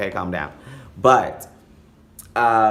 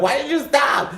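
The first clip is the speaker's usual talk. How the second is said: squealed very high; outraged; 4 dB louder than usual. Retold or imitated. Asking the hookup why he stopped, shrieked very high. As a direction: shriek the question in disbelief.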